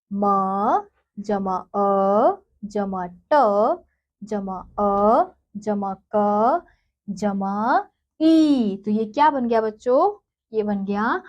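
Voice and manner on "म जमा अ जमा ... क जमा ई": stretched letter by letter; slow spelling out. Shown as a demonstration. Teacher sounding out a word for children, very slow. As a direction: build the word slowly, one sound at a time. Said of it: "ऐसे ही अगला शब्द है बच्चों".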